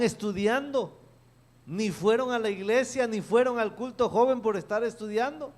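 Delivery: high, loud, complaining son's voice; a son complaining to his parents, high and loud; frustrated son. Retold or imitated. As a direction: vent like a stressed student on the phone.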